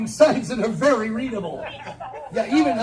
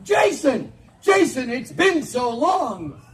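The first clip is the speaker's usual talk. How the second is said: loud, higher, slow delighted shout; hammed-up overjoyed call; mock reunion call; 5 semitones higher, 4 dB louder, slower than usual. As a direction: greet like a long-lost friend. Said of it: "by going".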